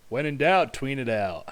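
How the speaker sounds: catchphrase. Pretend. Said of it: "That's what I always say".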